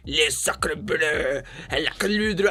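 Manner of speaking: mock French gibberish, drawn out; French critic. French critic confronting the noisy DJ outside, hammed-up French outburst. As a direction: sputter fake French with an exaggerated accent. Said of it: "the French critic walks out".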